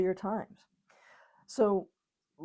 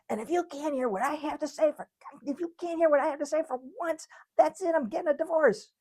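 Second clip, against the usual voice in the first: high-pitched, exasperated acted ultimatum; angry wife; 9 semitones higher, 3 dB louder than usual. Wife as you head for the door, high angry ultimatum. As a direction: threaten in a much higher, forceful voice. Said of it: "she says".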